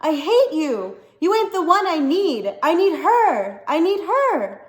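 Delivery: high, loud grief-stricken scream; furious scream. A narrator's reading. A son screaming at his father, wishing him dead. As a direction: hurl the words at him. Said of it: "he screamed".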